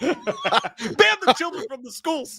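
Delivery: high, slow, laughing through it. Real, not acted. Repeating Jay's joke line, laughing hard. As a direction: squeeze the words out through laughter.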